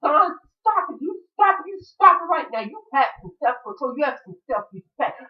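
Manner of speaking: high-pitched mock old lady; scolding old lady. Old lady stops a man choking someone, high scolding. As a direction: scold like an elderly woman breaking up a fight. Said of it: "this old lady comes out".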